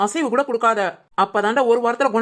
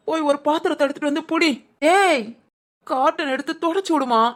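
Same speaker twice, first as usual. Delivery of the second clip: ordinary talk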